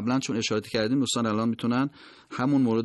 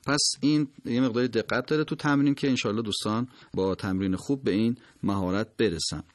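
ordinary talk

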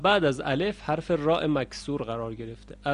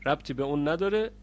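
ordinary delivery

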